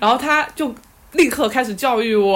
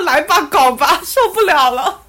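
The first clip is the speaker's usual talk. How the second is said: exasperated go-ahead; 4 semitones higher, 5 dB louder than usual. Retold or imitated. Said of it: "我说".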